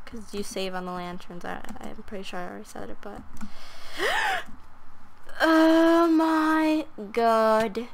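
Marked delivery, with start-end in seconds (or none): 3.3-5.3 sudden high unvoiced gasp; a startled intake of breath; sharp gasp. Real, not acted. Shocked moment in game. 5.4-7.9 stunned. Real, not acted. Reacting to game shock.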